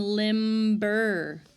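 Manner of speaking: "limber" slow and loud, B fully voiced; sounded B. Shown as a demonstration. Teacher contrasting silent and sounded letters, "limber" with a clear B. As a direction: stretch "limber" as LIMM-BER so the B stands out. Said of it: "this is the sounded".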